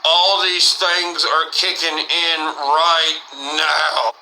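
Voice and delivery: very slow, dazed drawl; stoned dread. Retold or imitated. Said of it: "I'm sitting there like".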